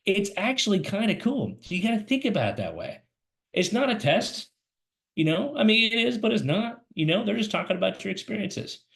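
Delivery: high, lively excited voice. Real, not acted. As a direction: sound thrilled about it. Shows excitement while advising.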